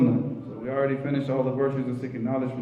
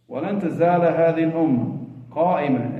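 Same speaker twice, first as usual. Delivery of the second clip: hadith recitation; 3 semitones higher, 4 dB louder, much faster than usual. Acted. Recites hadith phrase in Arabic.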